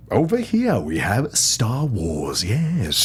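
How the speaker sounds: slow, posh-leaning British accent, drawn out. Shown as a demonstration. British accent performed on request for the podcast hosts. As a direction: show off the British accent the host asked for. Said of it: "can you just say Star Wars in a British accent".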